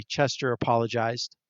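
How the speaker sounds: plain reading voice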